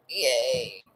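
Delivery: ordinary talk, nothing marked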